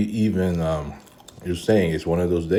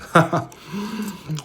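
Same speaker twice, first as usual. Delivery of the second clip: high laugh. Real, not acted. Laughs over his food.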